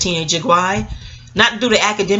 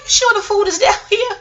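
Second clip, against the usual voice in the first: very high, squeaky mock doubt; naive doubt; 14 semitones higher than usual. Retelling herself questioning the friend about food, naive doubt. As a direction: voice way up, playing the gullible kid.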